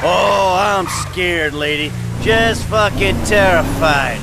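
mocking the shouting gangster with fake fear; deadpan sarcasm.

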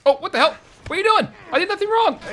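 ordinary talk, nothing marked

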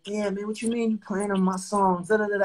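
put-out protest tailing into dismissive filler; retold complaint. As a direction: act out his younger self complaining.

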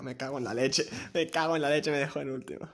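loud furious cursing; angry shouting. Real, not acted. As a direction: blow up at losing. Furious at losing. (gritando enfadado)

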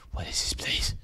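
low, hushed whisper; spooked whisper. Real, not acted. Scared player wondering where he is, spooked whisper. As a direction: whisper it to yourself, uneasy.

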